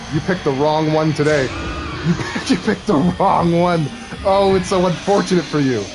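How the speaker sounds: loud, high playful taunting; hammed-up trash talk. Streamer to an enemy who picked the wrong one, loud mock threat. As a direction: crow threats excitedly over an easy win.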